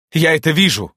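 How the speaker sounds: high, loud, stern reply; harsh, angry retort. Acted. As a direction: answer sharply in anger. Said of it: "довольно жёстко и гневно отозвалась". (жёстко и гневно)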